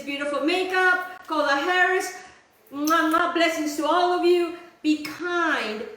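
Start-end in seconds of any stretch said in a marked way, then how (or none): 2.7-3.3 two affectionate mwah kisses; kissing sounds as a sign-off; blown kisses. Real, not acted. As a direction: blow two kisses to the viewers.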